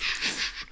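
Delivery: repeated soft, voiceless hushes; upset shushing. Retold or imitated. Upset birdwatcher silencing a walker, imitated shushing. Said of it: "he was so upset".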